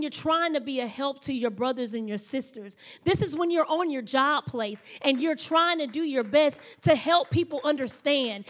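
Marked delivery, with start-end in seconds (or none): 3.0-8.5 building, louder preaching; rising sermon intensity. Acted. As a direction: drive the point home to the congregation.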